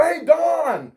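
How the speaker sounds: high shouted call across the shop. Retold or imitated. Calling coworker across coffee shop, loud shout. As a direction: call her name loud over the grinder. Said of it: "I'm gonna scream it out loud".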